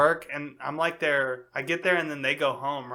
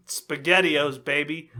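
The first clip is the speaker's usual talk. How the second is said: usual speaking voice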